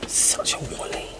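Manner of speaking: breathy whisper to herself; real whispered self-reproach; whispered self-scolding. Real, not acted. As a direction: hiss it under your breath at yourself. Muttering at herself.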